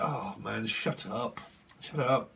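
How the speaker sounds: low, soft exasperated grumble; weary annoyance. Acted. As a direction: tell them off wearily under your breath. Weary reply to the irritating voice.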